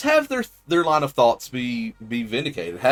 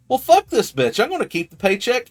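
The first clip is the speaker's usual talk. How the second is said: high, loud, brash player voice; greedy player; 11 semitones higher, 3 dB louder than usual. Retold or imitated. Player deciding to keep the dead man's paycheck, high and brash. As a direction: bark it like a greedy rogue.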